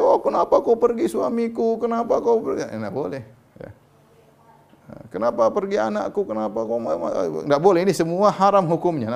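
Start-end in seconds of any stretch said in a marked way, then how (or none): 0.0-2.7 high, loud imitation of a lament; mock wailing. Preacher imitating a widow wailing for her husband, mock lament. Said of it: "kalau teriak-teriak". 5.1-7.5 high-pitched imitation of wailing; mock lament. Preacher imitating a parent crying out for a lost child, mock wailing. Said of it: "namanya meratap".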